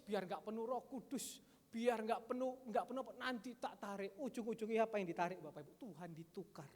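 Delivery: ordinary talk, nothing marked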